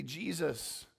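ordinary talk, nothing marked

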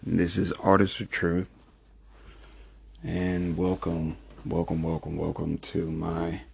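soft, hushed voice so as not to wake someone; low talking. Real, not acted. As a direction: keep it low, talking quietly. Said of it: "kinda low talking right now 'cause I don't want to disturb her".

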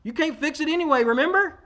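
high, playful refusal; jokingly defiant patient; mock defiant. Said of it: "And I said".

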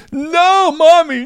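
high, loud mock crying; wailing child. Three-year-old begs mom not to spank him, wailing. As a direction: wail like a panicking toddler.

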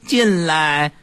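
high, drawn-out feeble call; weakly calling out from a sickbed; mock patient's long-drawn summons; hospital patient. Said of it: "然后病人说".

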